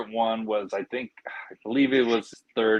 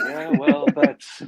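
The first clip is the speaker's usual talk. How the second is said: much higher voice through laughter; laughing falsetto; 6 dB louder, slower than usual. Retold or imitated. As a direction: squeak it out while cracking up. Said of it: "He's like".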